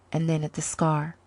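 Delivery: ordinary delivery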